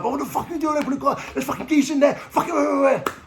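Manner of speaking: high, loud frantic yell; yelling at pal. Retold or imitated. Yelling at his pal who keeps driving the car forward, frantic high shout. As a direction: shout after the car pulling away. Friend drives off, he yells.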